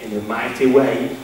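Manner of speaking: low, quiet voice of doubt; a touch of the worried inner voice. Retold or imitated. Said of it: "I'm thinking".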